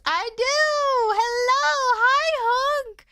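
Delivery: high bright greeting; cute anime streamer. Acted. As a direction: squeal hello to the raiders. Greeting raiders.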